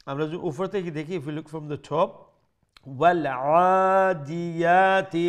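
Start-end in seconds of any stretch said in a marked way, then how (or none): 3.0-5.1 slow, drawn-out chant; recitation. Shown as a demonstration. Teacher reciting the verse slowly for students. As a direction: stretch the word like a reciter. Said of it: "teacher recites verse to explain".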